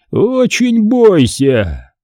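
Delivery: high, very slow menacing warning; ominous warning. A narrator's reading. Man warning the children, ominous and drawn out. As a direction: draw the threat right out, fully voiced. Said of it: "прошептал он".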